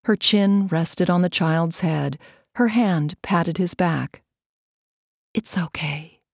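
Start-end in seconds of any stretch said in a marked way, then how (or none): 5.3-6.2 soft hushed whisper; a gentle whispered reassurance. A narrator's reading. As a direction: whisper it so the child stays asleep. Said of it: "Marta whispered to the sleeping child".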